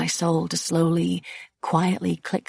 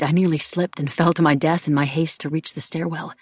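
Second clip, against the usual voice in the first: usual speaking voice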